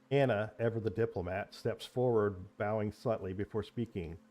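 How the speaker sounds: steady storyteller's narration; story narration. A narrator's reading. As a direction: set the scene like a game master. GM narrates the scene.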